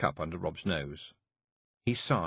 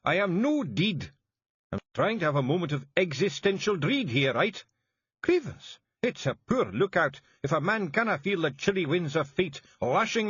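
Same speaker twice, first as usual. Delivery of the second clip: high, loud Scottish grumble; grumpy Feagle Rob; 11 semitones higher, 4 dB louder than usual. A narrator's reading. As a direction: Rob offended at being called dead. Rob rants.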